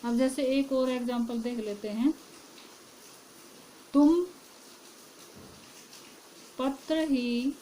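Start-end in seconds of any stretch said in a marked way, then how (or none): none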